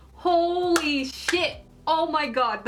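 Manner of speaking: thrilled exclamation over the toss; excited outburst. Real, not acted. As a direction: let it burst out slowly in awe. Fangirling over Tsukishima's toss.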